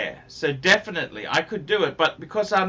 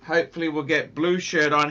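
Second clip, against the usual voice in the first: ordinary talk, nothing marked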